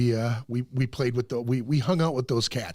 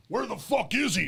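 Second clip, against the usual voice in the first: higher-pitched mock outrage; retold angry demand; 9 semitones higher than usual. Friend retells Glenn storming into the show, mildly angry. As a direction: act out someone storming in demanding answers.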